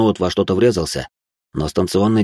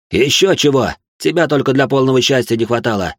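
outraged, higher and louder retort; indignant snap of refusal; 6 semitones higher, 4 dB louder than usual. Acted. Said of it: "возмутился Алёшин".

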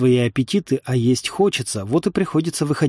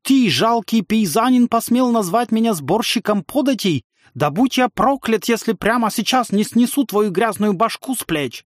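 put-on high-pitched, indignant Feidland accent; imitated Feidland accent; 9 semitones higher than usual. A narrator's reading. Rider threatening a lowly peasant, imitated Feidland accent. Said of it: "старательно имитируя акцент фейдландцев".

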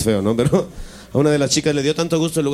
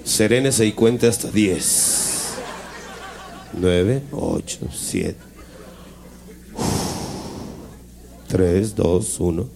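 lower, very slow soothing count; mock calming voice; 5 semitones lower, 4 dB quieter, much slower than usual. Calming the furious father, slow and soothing. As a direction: count him down gently, hammed up.

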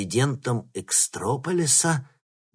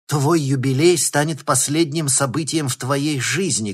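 shouted threat; 5 semitones higher, 6 dB louder than usual. Acted. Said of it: "крикнула она".

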